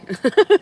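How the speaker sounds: a sudden high, loud laugh; high laugh burst. Real, not acted. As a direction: let out a squealing laugh. Laughs after mom quote.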